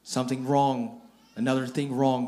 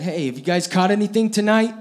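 calling out; 8 semitones higher, 9 dB louder than usual. Retold or imitated. Jesus calls out from shore.

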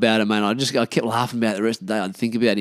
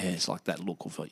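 normal talking voice

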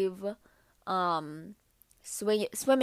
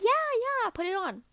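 mock mom, much higher and bright; pushy mom; 13 semitones higher than usual. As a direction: coax her into it, higher. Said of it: "she was like".

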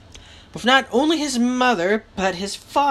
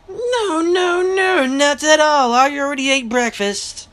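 polite refusal; 5 semitones higher, 4 dB louder than usual. A narrator's reading. Lightwood declining kippers from his host, high and polite.

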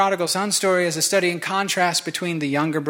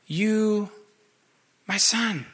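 higher, gentle tender address; 5 semitones higher, much slower than usual. Acted. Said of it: "with tenderness".

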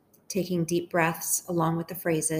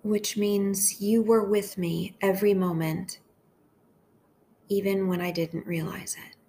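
gentle, unhurried meditation voice with long pauses; hushed, measured devotional leading; guided prayer meditation. Acted.

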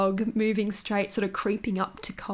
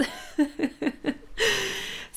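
laugh; 11 semitones higher than usual. Real, not acted. Laughing at her own bliss.

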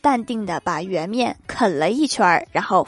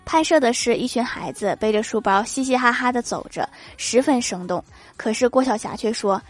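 normal talking voice

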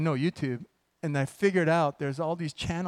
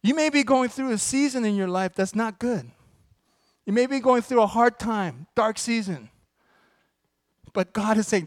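high, loud preaching build; full-force preaching; 5 semitones higher, 6 dB louder than usual. Real, not acted. Pastor preaching to the congregation about God's plan, full-force. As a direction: preach it out to the back row.

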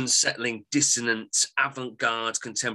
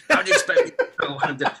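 laughing; 6 dB louder, faster than usual. Real, not acted. Laughing at own muddle.

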